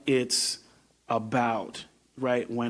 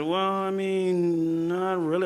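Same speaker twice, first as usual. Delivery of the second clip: higher, slow protest; 7 semitones higher, slower than usual. Retold or imitated. His retold reply.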